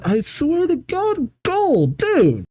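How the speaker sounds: high-pitched exasperated squeal; appalled, voice shooting up. Real, not acted. Exasperated reaction to Gold's remark.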